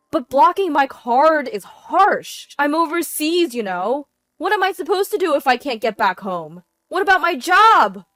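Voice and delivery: loud, high-pitched complaint; panicked protest. A narrator's reading. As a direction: protest frantically that you're stranded. Husband protests being stranded.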